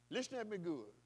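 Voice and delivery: ordinary delivery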